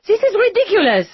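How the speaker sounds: high, loud fast snap; exasperated outburst. A narrator's reading. Lisette refusing to sit for the detectives, exasperated. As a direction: blow up in frustration.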